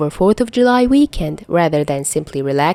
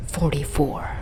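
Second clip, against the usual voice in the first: bitter thought; 3 semitones lower, 7 dB quieter than usual. A narrator's reading. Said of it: "Tony thinks bitterly".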